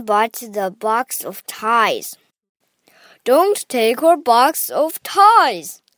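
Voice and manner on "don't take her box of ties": higher, louder, slow mock plea; crying out in protest. A narrator's reading. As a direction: wail it out slowly like upset Grandpa. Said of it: "cried Grandpa".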